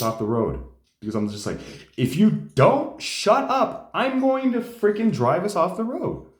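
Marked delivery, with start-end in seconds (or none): 2.0-6.3 high, loud furious threat at the brother; angry yell. Retold or imitated. Driver yelling at his prodding brother, furious. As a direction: yell it like you're about to crash the car.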